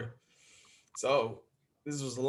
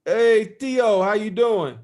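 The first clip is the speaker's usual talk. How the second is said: dad's loud, higher phone greeting; 5 semitones higher, 7 dB louder, much slower than usual. Retold or imitated. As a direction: call out cheerfully to the nephew. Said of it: "he picks up the phone".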